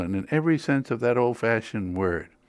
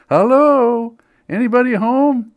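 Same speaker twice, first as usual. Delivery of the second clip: high, loud quavering call; quavering old woman calling; 11 semitones higher, 8 dB louder than usual. Retold or imitated. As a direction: call out like an elderly lady. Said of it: "an elderly woman's quavering voice crying out".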